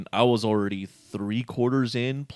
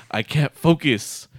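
ordinary talk, nothing marked

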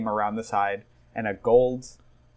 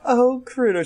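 high, adoring sigh of a lady; swooning damsel; 12 semitones higher, 4 dB louder than usual. Acted. Lady to Crudo, high swooning damsel. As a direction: swoon over your hero.

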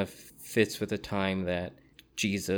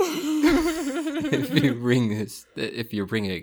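laughing; 6 semitones higher, 9 dB louder, much slower than usual. Real, not acted. Laughing retelling lyric.

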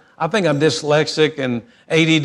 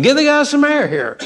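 surgeon's order; 10 semitones higher than usual. Retold or imitated. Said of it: "kind of like a surgery team".

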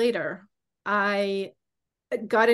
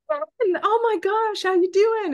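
high, bright, gushing "Ben, how you doing"; excited greeting; 12 semitones higher, 5 dB louder than usual. Retold or imitated. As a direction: squeal a delighted hello, much higher and a bit louder.

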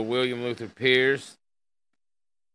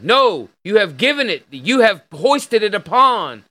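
loud, high, indignant objection; emphatic rebuttal; 7 semitones higher, 9 dB louder than usual. Real, not acted. Host rebutting the article's writer, indignant rebuttal. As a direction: push each point up high and loud, slowing down.

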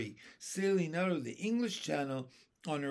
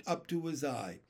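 ordinary delivery

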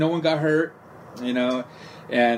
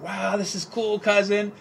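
a lightly voiced awed cousin, pitched up; amazed cousin; 7 semitones higher, slower than usual. Cousin marveling at the cruise strip, lightly voiced awe. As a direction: gush over the scene like a wide-eyed newcomer.

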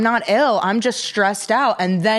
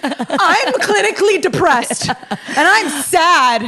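mock melodramatic whine, a bit higher; mildly voiced mopey girl; mock dramatic; 5 semitones higher than usual. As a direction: lightly send up a sad girl. Mocking girls' excuse.

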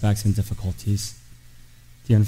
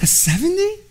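shocked disbelief. Retold or imitated. Father shocked at daughter's grade.

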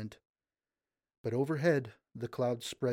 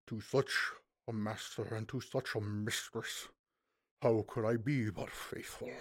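humble loyal chancellor; 3 dB quieter than usual. A narrator's reading.

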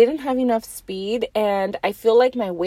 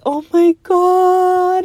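high, slow stretched oh my God; replaying the shock of the fall, drawn out; shocked gasp; 10 semitones higher, 7 dB louder, much slower than usual. After sliding on concrete, a stretched shocked oh my God.

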